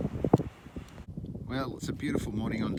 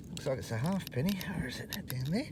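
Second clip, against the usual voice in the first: under-breath whisper to himself; 2 semitones higher, 9 dB quieter than usual. Real, not acted. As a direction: whisper it, searching the hole.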